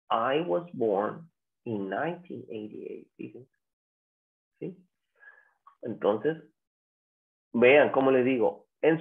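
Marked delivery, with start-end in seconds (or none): 0.1-3.1 low, soft, slow "nineteen eighty-eight" in two chunks; natural year in pairs. Shown as a demonstration. Teacher repeats the model for students, slow soft year in pairs. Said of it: "vamos a decir".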